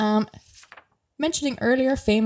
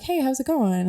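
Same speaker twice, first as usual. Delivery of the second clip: higher, casual mock-celebrity hello; friendly greeting; 7 semitones higher than usual. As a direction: toss off a breezy hi. Voicing Colin Farrell's greeting.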